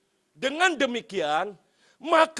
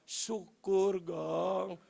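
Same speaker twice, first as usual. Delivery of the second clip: restrained, drawn-out aside of relief; secret gloating; 2 semitones lower, 4 dB quieter, much slower than usual. Retold or imitated. Boss's hidden thought about departing driver, restrained gloating. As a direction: savour it privately, mock-gleeful and held back. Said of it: "Padahal mah".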